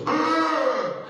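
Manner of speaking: loud, high groan of outrage; crowd outcry. Retold or imitated. Gym crowd reacting to a boy's fall, outraged outcry. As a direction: imitate a whole gym crying out. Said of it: "The whole place goes".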